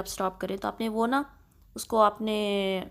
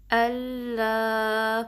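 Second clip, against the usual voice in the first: very slow, drawn-out recitation of the greeting; much slower than usual. Shown as a demonstration. Teacher reciting the phrase for students to repeat. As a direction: stretch each vowel in a measured chant. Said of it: "حمزہ کو directly لام سے ملانا ہے".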